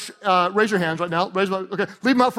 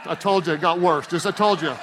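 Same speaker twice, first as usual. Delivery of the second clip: usual speaking voice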